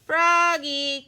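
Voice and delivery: high, loud drawn-out call; mother hollering for her son. A narrator's reading. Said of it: "called his mother".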